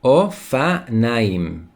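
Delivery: "ofanaim" drawn out syllable by syllable; slow model pronunciation. Shown as a demonstration. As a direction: say "ofanaim" very slowly for learners.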